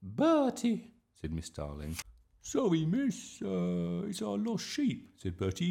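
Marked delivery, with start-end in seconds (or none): 0.0-0.9 high, loud reproachful call; scolding teacher. A narrator's reading. 2.4-5.1 put-on high-pitched child making excuses; apologetic schoolboy. A narrator's reading.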